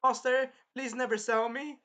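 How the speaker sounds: imitated worried begging; retold frightened plea. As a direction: plead as a scared servant, lightly acted. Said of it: "Philo was terrified as shit".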